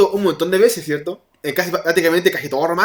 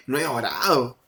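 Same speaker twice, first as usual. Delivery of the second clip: confesión más grave y bajita; admítelo bajando la voz; confesión apocada; 8 semitones lower, 6 dB quieter than usual. Retold or imitated. Confesión actuada tras dejar de orar. (confesión actuada, en voz baja)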